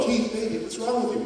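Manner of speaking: mother softly asking her son what's wrong; soft worried mom. Retold or imitated. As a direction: gently coax the hurt child.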